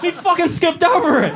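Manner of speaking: ordinary talk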